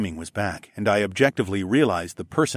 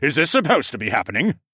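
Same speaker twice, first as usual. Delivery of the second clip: pressing, louder and higher; raised demand; 5 semitones higher, 4 dB louder, faster than usual. Acted. Councilman questioning the hearing, still barking. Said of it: "I heard the councilman bark".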